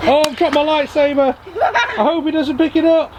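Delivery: very high-pitched, hammed-up alarm; mock panic. Vlogger fooling with a lightsaber, about to be caught, mock panic. Play-acted lightsaber fight mishap.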